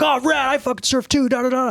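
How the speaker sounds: high-pitched surfer-bro voice for Kurt; imitating Kurt. Narrator imitating the stranger who asks if he surfs, high bro voice. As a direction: pitch way up, eager stoner bro.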